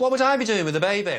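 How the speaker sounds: high, loud, indignant question; incredulous denial. Acted. Friend wrongly thought to have a baby, incredulous. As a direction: protest in disbelief, pitch jumping up.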